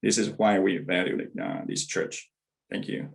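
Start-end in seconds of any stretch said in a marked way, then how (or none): none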